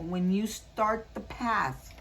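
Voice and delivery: drawn out and slow, leading up to the point. Real, not acted. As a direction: say it slowly, setting it up. Repeats the card message for emphasis.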